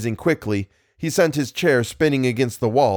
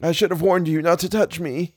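moaned; 7 semitones higher than usual. A narrator's reading. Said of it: "he moaned".

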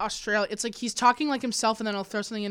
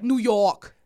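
put-on New York accent. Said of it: "a Brooklyn accent".

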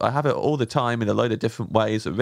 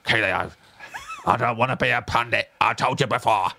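Roy Kent voice. Pretend. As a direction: growl it out like a grumpy ex-pro. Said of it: "get into the Roy Kent thing".

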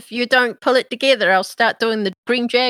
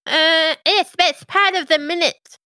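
higher, matter-of-fact imp; brisk little servant imp; 6 semitones higher, slower than usual. Acted. GM voices imp.

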